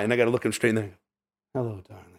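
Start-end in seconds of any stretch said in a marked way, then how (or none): 1.5-2.1 soft, breathy seductive purr; breathy vintage purr. Pretend. Voicing seductive dragon character.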